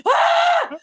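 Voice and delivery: loud high mock yelp; sudden high shouted ah; startled yelp. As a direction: yelp like you got zapped. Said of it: "and I went".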